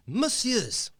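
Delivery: high, lightly French address; lightly voiced French greeting; French accent. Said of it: "probably not in a French accent".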